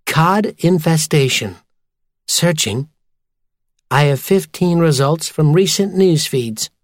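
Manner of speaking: phone assistant reading out results; computer voice. A narrator's reading. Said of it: "the computer voice sprang to life".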